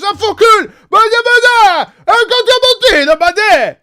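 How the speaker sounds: high, loud exasperated yelling; angry French driver. Retold or imitated. Other driver yelling about the mirror, loud and high. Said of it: "the other guy was".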